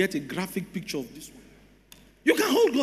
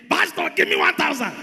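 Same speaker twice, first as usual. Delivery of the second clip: raised, high-pitched demand; higher-pitched mock shout from the congregation; imitated shouting; 8 semitones higher, 4 dB louder than usual. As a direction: call out to the pastor, mimicking a shouter. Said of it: "stay there and be shouting".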